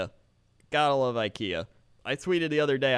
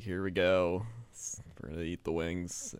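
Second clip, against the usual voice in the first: unenthused announcer; 8 semitones lower, 7 dB quieter than usual. Acted. Bad announcer hosting the wing challenge, low and listless. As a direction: announce it with no energy at all.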